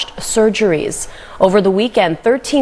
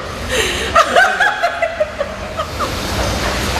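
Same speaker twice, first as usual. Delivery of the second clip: burst of laughter. Real, not acted. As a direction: break into laughter. Laughs after beet juice.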